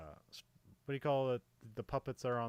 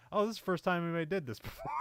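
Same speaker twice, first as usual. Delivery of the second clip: excited; 7 semitones higher, 4 dB louder, much faster than usual. Pretend. Imagined first-time audience excitement.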